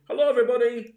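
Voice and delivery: loud, high cheery call. Pretend. Neighbour calling out from his doorstep, loud. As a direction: shout the greeting out the door.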